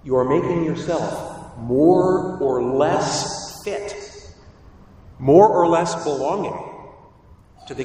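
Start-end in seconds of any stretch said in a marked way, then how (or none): none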